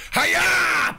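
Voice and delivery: high shouted "Hi-ya!" like a karate yell; mock battle cry. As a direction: yell it like a martial arts kick. Dog cannonballs onto lap.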